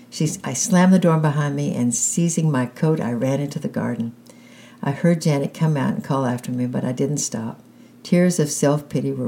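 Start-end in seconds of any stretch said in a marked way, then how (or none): none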